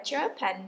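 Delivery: "Japan" said JA-pan, like German; mistakenly front-load "Japan"; "Japan" with a weak second syllable; stress on first syllable. Shown as a demonstration. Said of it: "German vậy đó".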